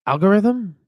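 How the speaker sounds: high, questioning try at the name; unsure guess. Real, not acted. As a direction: sound out the word Algorithm as a puzzled guess. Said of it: "Oh my God, I'm such a".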